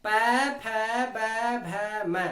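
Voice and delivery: पै, फै, बै, भै, मै said slowly, each closing both lips; slow lip-closed syllables पै फै बै भै मै for students; labial sounds demo. Shown as a demonstration. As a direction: sound out पै, फै, बै, भै, मै one by one, lips pressed shut. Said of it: "पै वर्ग को हम बोल के देखते हैं".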